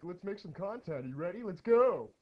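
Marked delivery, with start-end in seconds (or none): 1.4-2.1 loud hype call to start; excited. Real, not acted. Streamer hyping viewers, loud excited call. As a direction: pump up the start.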